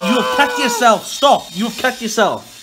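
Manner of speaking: loud, slow, insistent shout; alarmed warning. Real, not acted. Warning a mate who has cut themselves, alarmed shout. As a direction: shout it slowly to make them stop.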